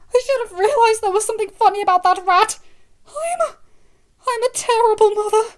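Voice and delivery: high, loud, near tears; anguished self-blame; distraught. Acted. Mother distraught, eyes moist.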